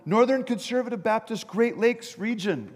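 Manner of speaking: high, called-out answer; mock yell down. Jumper answering down from the bridge, mock yelling. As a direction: yell it down, stretching the final words. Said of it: "the man yelled down".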